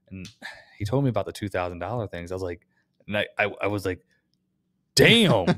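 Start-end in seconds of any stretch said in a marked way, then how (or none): 4.9-5.5 a loud, high exclamation of disbelief; retold shocked outburst, much higher and louder; stunned damn. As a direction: blurt it out, floored by the price. Shocked reaction to the two-thousand-dollar bill.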